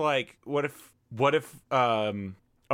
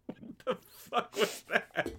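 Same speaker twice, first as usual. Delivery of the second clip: high, slow, laughing through words; real laughter breaking in; 11 semitones higher, 4 dB quieter, slower than usual. Real, not acted. Laughing at own odd sound.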